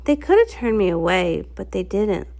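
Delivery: ordinary delivery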